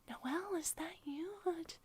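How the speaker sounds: hushed whisper, peering closer. Retold or imitated. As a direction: whisper it as if unsure who's in the costume. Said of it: "look at the wolf and be like".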